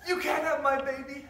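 melodramatic soap actor; soap opera drama. Acted. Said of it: "set on that soap opera".

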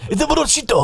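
very high cartoon Japanese, ending in O; mock Japanese accent. Shown as a demonstration. Showing the O ending, mock Japanese accent. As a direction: cry it high with an O stuck on. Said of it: "put an O at the end".

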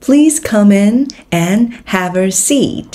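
whole sentence very slow, with "come in" linked. Shown as a demonstration. Teacher repeats the example slowly for learners. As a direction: model it slowly, linking the words. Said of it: "Nối âm".

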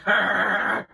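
growling grunt of effort; mock growl. Kid jabbing with the stick growls, imitated. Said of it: "He was like".

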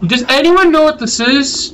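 high-pitched baffled query; puzzled appeal. Real, not acted. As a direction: raise the voice high, asking anyone at all. Asking viewers about mystery thing.

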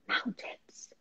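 breathless hushed whisper of wonder; awed whisper. Retold or imitated. A scared child seeing mountains from the plane, whispering. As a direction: whisper it like a timid child in awe. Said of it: "was afraid to talk".

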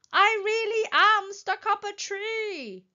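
high, slow, loud wail; plaintive insistence. A narrator's reading. Stuck Wilbur tries to convince the elephants, wailing. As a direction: insist in a high, drawn-out call.

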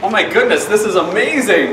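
high, loud delighted amazement; overjoyed makeover reaction; excited gasp. Acted. Reacts to the reveal.

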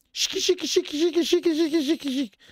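high, rapid shiki-shiki chirps; imitated insect chirring. Narrator imitates the forest creatures. (frinire acuto d'insetto imitato)

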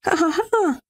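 high staged "ha ha ha"; involuntary laugh, acted. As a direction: laugh out high as if unable to stop. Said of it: "Zu dumm, dass ich lache".

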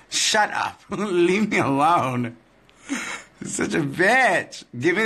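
fake-offended playful jab at a friend; playful mock offense. Real, not acted. Bickering with Monty who threatens to edit her out, playful mock offense. Teasing Monty back, laughing.

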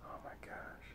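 a breathless unvoiced gasp of words; awed whisper. Real, not acted. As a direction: whisper it, stunned. Stunned by the card.

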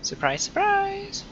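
high, slow mocking taunt; drawn-out singsong gloat; sing-song teasing.

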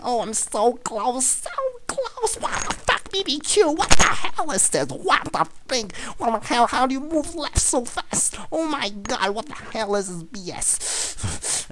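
very high, squealing disbelief; frantic outrage. Real, not acted. Gamer robbed of a close kill, high frantic squeal. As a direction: wail in frustration, voice way up high. Gamer losing at the crucial moment.

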